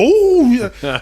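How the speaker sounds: high, loud drawn-out oh yeah; mock impressed. Gym owner seeing the kid's abs, hammed-up awe. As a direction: gush in mock admiration.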